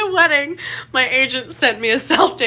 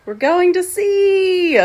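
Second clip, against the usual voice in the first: raised, loud, gleeful cry; excited squeal; 4 semitones higher, 6 dB louder than usual. Real, not acted. Going to sea, excited squeal. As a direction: squeal it, thrilled. Delight at being on boat.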